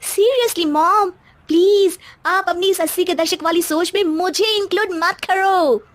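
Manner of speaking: put-on high, drawn-out bratty teen; spoilt daughter voice. Daughter brushing off her father, high and bratty. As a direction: sass your old-fashioned dad from up high. Mohini to mother-in-law.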